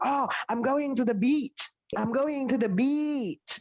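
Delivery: "beach" with a long rising ee, slowed on the repeat. Shown as a demonstration. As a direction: draw out the ee in "beach". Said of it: "Dia pakai suara I, beach".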